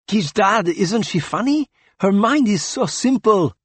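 high and teasing, pleased with himself; gleeful boy. A narrator's reading. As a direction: show off to dad like a delighted kid. Gleeful child showing off cruel trick.

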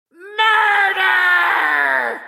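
very high, loud drawn-out screech; parrot shriek. A narrator's reading. The other parrot head answers in the darkness, shrieking. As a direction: shriek it long and piercing like a parrot. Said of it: "shrieked the other".

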